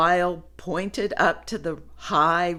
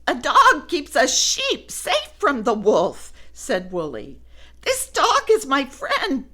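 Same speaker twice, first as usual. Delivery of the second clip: high, friendly explaining; bright introductions; cheerful sheep voice; 6 semitones higher, 4 dB louder than usual. Acted. Woolly explains.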